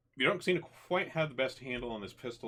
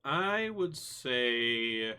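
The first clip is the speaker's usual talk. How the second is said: very slow, drawn-out estimate, a little higher; pondering; 4 semitones higher, 4 dB louder, much slower than usual. Real, not acted. Game master guessing the ceiling height, pondering. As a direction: stretch each word while deciding.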